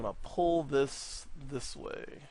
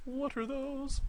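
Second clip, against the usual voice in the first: high, softer "What are those?"; high meme squeal; 12 semitones higher, 5 dB quieter, slower than usual. Pretend. Repeating the meme to viewers, a high soft squeal. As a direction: toss the meme line up high and light. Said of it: "repeats the meme line".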